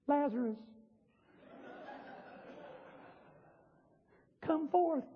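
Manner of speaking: far too soft to raise anyone; hushed, feeble summons; mock timid call. Shown as a demonstration. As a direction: call out meekly, barely audible. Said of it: "N-, no. He said in a voice".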